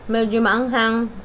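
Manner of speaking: talking with food at lips; words around a bite; biting into chicken. Real, not acted. Seen 0: Left speaker holds chicken wing at lips, biting while talking.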